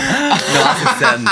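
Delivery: high, mock-prim no, that's a sin; mock scandalized. Scolding her friend in a high, mock-prim voice. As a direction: play the shocked prude.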